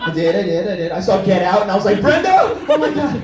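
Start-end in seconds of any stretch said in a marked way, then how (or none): 2.0-3.2 high, loud horrified realization; mock alarm. Comic to his nanny after seeing Get Out, high mock alarm. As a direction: cry out to her in comic shock.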